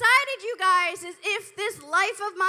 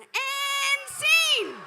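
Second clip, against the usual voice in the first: announcing end of scene; 8 semitones higher than usual. Acted. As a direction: call the scene closed like a director. Ending his pitched porn scene.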